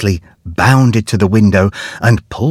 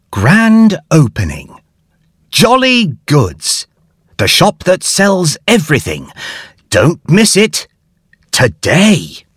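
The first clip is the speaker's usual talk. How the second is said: leaflet read out; 6 semitones higher than usual. A narrator's reading. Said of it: "A leaflet read".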